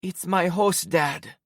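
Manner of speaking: put-on high, slow tearful girl voice; sobbing girl. A narrator's reading. Em over her host dad's body, sobbing. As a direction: voice Em through sobs. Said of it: "she sobbed".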